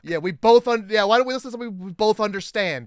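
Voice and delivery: loud, hurried complaint; hammed-up fed-up protest; mock exasperation. Podcaster as husband to handyman about the radio, mock exasperated.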